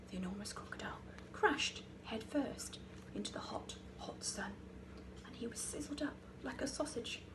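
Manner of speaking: soft, breathy hush; hushed finish. A narrator's reading. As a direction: drop to a whisper for the end. Crocodile crashes into sun.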